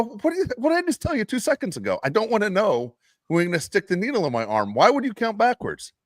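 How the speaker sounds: high, incredulous mock scolding of the nurse; retold exasperation. Patient retelling how he scolded the nurse, high mock exasperation.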